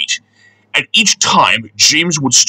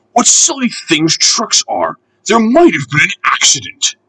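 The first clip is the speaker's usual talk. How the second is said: scornful engine; 8 semitones higher than usual. A narrator's reading. James scorns trucks.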